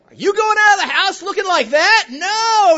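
loud, high exclamation of disbelief; mock outrage. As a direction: play the appalled husband at full volume. Mock reaction to wife's outfit.

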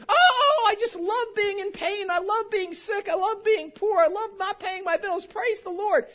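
high, gushing mock cheer; flippant mock joy. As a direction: sing out pain like it's fun. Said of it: "I don't walk around flippantly".

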